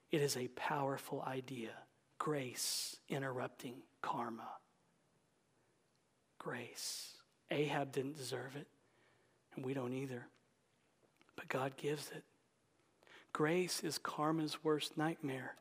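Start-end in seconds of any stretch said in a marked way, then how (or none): 0.1-10.3 low, soft, near-whisper; hushed reverence. Real, not acted. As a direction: let each word sink in quietly. Hushed, weighty close of sermon.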